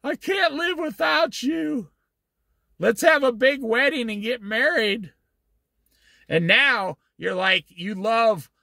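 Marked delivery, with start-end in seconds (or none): 0.0-5.1 high, pleading, whiny plea; mock begging. Preacher mocking a husband begging his girlfriend, high whiny pleading. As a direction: ham up a desperate suitor begging. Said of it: "You were begging her".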